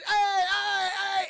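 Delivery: high, loud drawn-out hollers; imitated attention-grabbing yell; retold calling out. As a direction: shout to draw a crowd's attention. Retold distraction shouted at horde.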